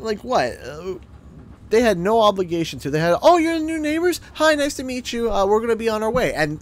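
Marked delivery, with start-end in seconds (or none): 3.2-6.3 mock cheery neighbor. Imagined neighbors' brief polite greeting.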